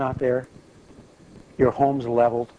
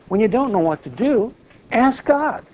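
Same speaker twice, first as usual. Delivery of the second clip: rising exhortation; 8 semitones higher than usual. Real, not acted. Preacher urging the congregation to seek God, ringing and high. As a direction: build to a ringing cry.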